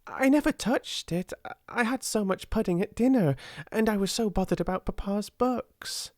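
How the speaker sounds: high, eager, racing ahead; lightly voiced excited girl; excited hurry. A narrator's reading. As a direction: spill the news in a rush. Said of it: "in an excited hurry".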